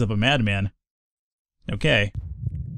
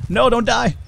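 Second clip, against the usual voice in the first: panicked plea; 10 semitones higher, 3 dB louder than usual. Real, not acted. Pleading as health drops.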